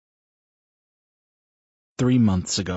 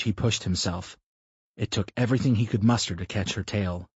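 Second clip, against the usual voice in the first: ordinary delivery, nothing marked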